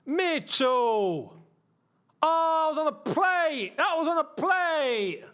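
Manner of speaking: high, slow wailing complaint; anguished lament. Real, not acted. Fan lamenting to the player who missed, high slow wail.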